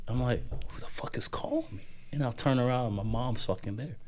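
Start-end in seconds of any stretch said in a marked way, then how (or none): 0.7-1.8 high, breathless whispered aside; mock whisper. Son startled by his name on campus, whispered. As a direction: whisper it like you're startled and hiding. Retold startled inner thought.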